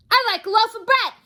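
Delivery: high, loud booming demand. Acted. Mister Noisy orders bread from the baker's wife, booming. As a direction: boom it across the shop. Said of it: "he boomed".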